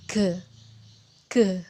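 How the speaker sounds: G as a hard "guh" with throat vibrating; voiced G sound. Shown as a demonstration. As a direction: say G with lips open, buzzing in the throat. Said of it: "dipegang area leher juga terjadi getaran". (bunyi G, bibir dibuka, leher bergetar)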